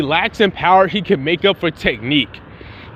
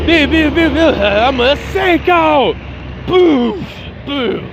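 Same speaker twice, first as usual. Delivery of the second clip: furious punch noises; 7 semitones higher, slower than usual. Retold or imitated. Enraged Gohan pummelling eighteen, shouting hit sounds. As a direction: shout every blow like a raging kid.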